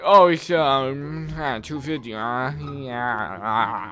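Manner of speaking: low, slurred mumble with long drawn-out ums; mumbling driver. Retold or imitated. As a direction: imitate an unintelligible mumbling cabbie, slowly. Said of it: "He, like, mumbled back".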